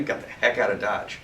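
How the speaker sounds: usual speaking voice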